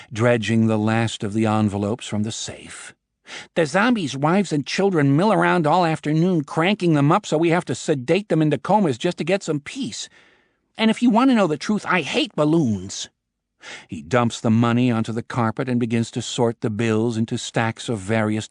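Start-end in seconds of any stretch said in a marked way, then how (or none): 3.5-13.1 griping boss voice. A narrator's reading. Boss griping about the festival.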